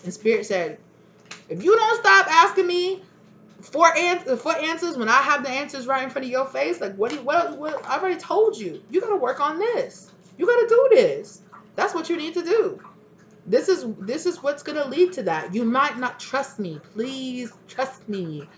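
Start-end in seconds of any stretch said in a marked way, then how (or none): 1.5-15.6 high-pitched fed-up spirit, bursts of loudness; exasperated scolding. Acted. 15.6-18.5 slow, high plea, softening at the end; drawn-out pleading. Acted. Spirit pleads to be trusted.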